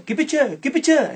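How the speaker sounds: high, loud mock Tucumán twang; mock Tucumán accent. Policeman mocks Tucumán suspects' accent.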